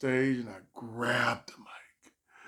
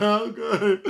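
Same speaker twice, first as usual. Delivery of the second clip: high loud fake-cheerful okay; fake smile; 8 semitones higher, 8 dB louder than usual. Nervous comic to host, fake cheerful smile. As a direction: smile through it falsely. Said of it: "with the fake smile".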